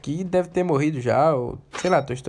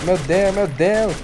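excited exclamation; 4 semitones higher, 5 dB louder, much slower than usual. Real, not acted. Startled during a firefight.

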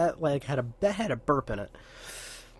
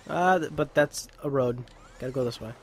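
ordinary talk

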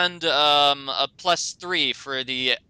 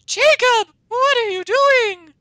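very high, loud scandalized shriek; gasp, then squeal it slowly in outrage; hammed-up horrified girlfriend; shocked gasp; 7 dB louder, slower than usual. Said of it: "Bella appears and she gasps".

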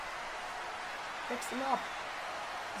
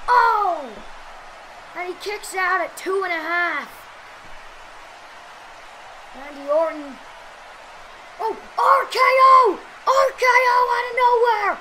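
wrestling commentator. Acted. Live wrestling match play-by-play.

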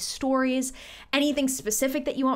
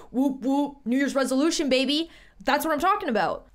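excited cheer; 4 semitones higher than usual. Real, not acted. Cheering about New Year's resolution.

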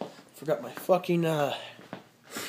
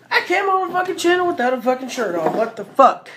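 surprised outburst; 6 semitones higher, 5 dB louder than usual. Real, not acted. Realizes he's shirtless on camera.

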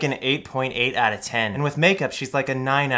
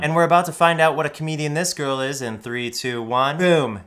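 usual speaking voice

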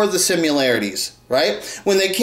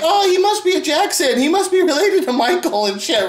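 high mock gushing fan voice; 9 semitones higher than usual. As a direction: ape a gullible fan, pitched up. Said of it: "And then they're like".

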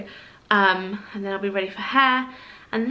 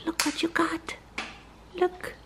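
whisper; 7 semitones higher, 8 dB quieter than usual. Real, not acted. Whispering to newborn Iris, soft and hushed. Showing newborn baby something.

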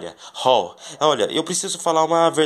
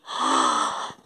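gasp; 3 dB quieter than usual. Pretend. Seeing the secret garden.

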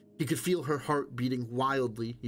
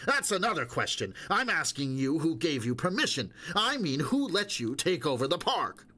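higher, insistent questioning; an official raising his voice; raised voice; 6 semitones higher than usual. A narrator's reading. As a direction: push harder, indignant. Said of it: "He raised his voice".

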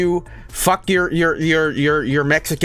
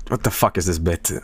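usual speaking voice

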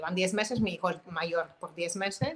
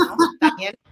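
sharp loud no, firm and final; stern scolding; 2 semitones higher, 10 dB louder than usual. Retold or imitated. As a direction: scold the boy at full volume. Mother scolding son in store.

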